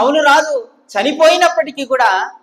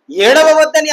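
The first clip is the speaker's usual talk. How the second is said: a firm, louder repeat of ఏడవవద్దని; God urges the grieving mother not to cry, louder; louder urging; 5 semitones higher, 7 dB louder than usual. Acted. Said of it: "వెళ్ళి".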